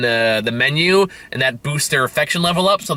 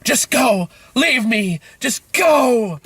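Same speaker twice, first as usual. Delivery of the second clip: high, slow melodramatic pleading; a mock tearful "just go", higher and stretched; hammed-up dramatic farewell; 10 semitones higher, much slower than usual. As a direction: overplay a heroic sacrifice scene. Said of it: "They go like".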